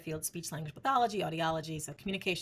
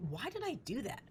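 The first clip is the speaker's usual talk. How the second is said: higher, softer wondering to oneself; puzzled self-questioning; 6 semitones higher, 8 dB quieter than usual. Acted. Speaker voicing inner work after judging a mother, puzzled self-questioning. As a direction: quietly question your own judgment.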